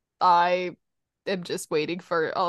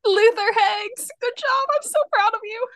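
excited; 13 semitones higher, 7 dB louder than usual. Real, not acted. Praising correct guess.

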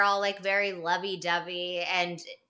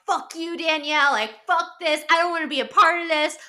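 much higher, louder mock outburst; imitating furious rant; 9 semitones higher, 6 dB louder than usual. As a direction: blow up like she's storming off. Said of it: "Lindsay's furious".

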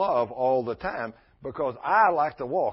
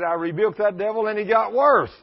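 loud exclaim; 6 semitones higher, 8 dB louder than usual. Retold or imitated. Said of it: "And he said".

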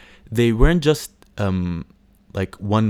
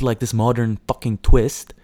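ordinary delivery

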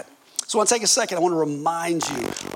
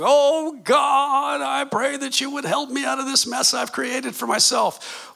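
high, wailing plea, put on; hammed-up desperate cry to God; mock crying prayer; 8 semitones higher, slower than usual. Preacher mimicking a desperate prayer for help, mock crying. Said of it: "This isn't like crying like".

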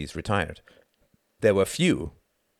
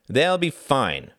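slow, raised, dismissive closing note; annoying finality; 7 semitones higher, 5 dB louder, much slower than usual. A narrator's reading. Frederick dismisses Reginald's worry about India, with finality. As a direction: close the subject, stretching it out. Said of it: "in the annoying tone of finality".

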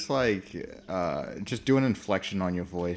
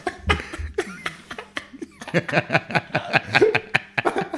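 long breathy fit of laughter; 8 semitones higher than usual. Real, not acted. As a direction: laugh hard and long, higher. Laughing at a friend's slip.

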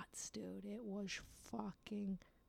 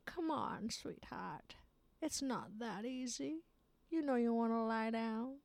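high, slow, whispery coaxing villain; seductive villain; 6 semitones higher, slower than usual. Acted. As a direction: tempt them to lie down like a sly villain. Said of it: "It's like the villain".

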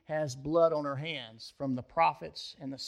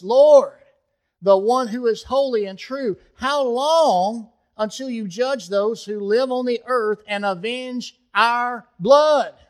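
higher and much louder, pleading; loud cry; 6 semitones higher, 9 dB louder than usual. Retold or imitated. Martyrs under the altar cry out to God, loud and high. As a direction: cry out to heaven, slowing at the end. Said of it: "they cried out with a loud voice".

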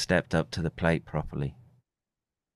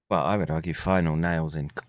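usual speaking voice

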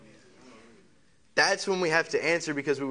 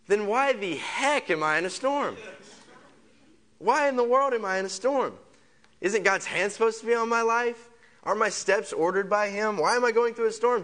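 higher and louder, pleading why; building frustration at the storm; rising exasperated questioning; 8 semitones higher, 3 dB louder than usual. Acted. Preacher voicing a believer's frustrated questions, louder and higher. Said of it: "rising questions in the sermon".

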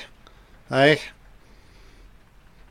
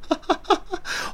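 a short, high, airless giggle; a quick high breathless laugh; 9 dB quieter than usual. Real, not acted. Watching an absurd scene, a short high giggle.

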